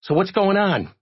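high-pitched, eager questioning; high little character voice; inquisitive reporter. A narrator's reading. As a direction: press for news in a high voice. Reporter presses Jay for news.

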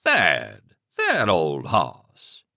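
villain's drawl, very slow, faking hurt feelings; mock injured. Grinning kidnapper answering the husband's threat, mock-injured drawl. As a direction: pretend to be wounded while grinning. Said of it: "Stark sounded injured".

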